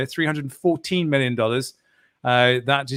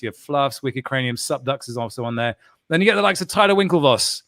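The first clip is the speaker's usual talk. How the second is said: usual speaking voice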